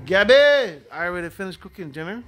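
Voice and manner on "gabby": loud call across the house. Real, not acted. Grandpa calling granddaughter Gabby from another room, loud call. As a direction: call her name out loud to the next room.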